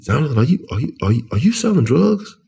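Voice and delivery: halting start, then the pitch climbs; retold questioning officer. Woman officer questioning him about drugs, mildly acted incredulous question. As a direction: imitate her question, rising high at the end.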